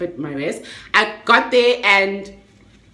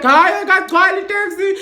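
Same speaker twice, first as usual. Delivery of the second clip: driver objecting; 10 semitones higher, 6 dB louder than usual. Retold or imitated. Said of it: "The driver is like".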